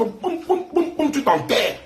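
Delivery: boum boum lancés haut en riant; coups de marteau imités. Retold or imitated. Mimics hammering blows in a rant. (bruitage moqueur de marteau, voix haute)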